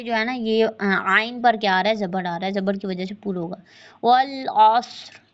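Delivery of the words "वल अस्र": अस्र with ra only slightly full; lightly heavy ra. Shown as a demonstration. Teacher showing the pausal ra. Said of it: "ये थोड़ा ही पुर होगा".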